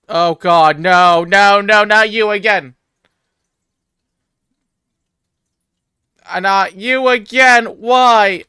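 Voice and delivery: loud, slow, high dread; wailing in horror at the doll; terrified horror victim. Real, not acted. Creepy doll appears again.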